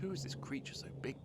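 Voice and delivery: hushed, breathy whisper in awe; awed whisper. Acted. Gruffalo's child seeing the huge shadow, awed whisper. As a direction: whisper it, frightened.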